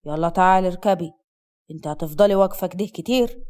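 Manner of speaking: low, sharp male command. A narrator's reading. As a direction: order her in, curt and impatient. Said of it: "أسد بصوت حاد".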